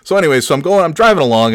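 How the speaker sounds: ordinary talk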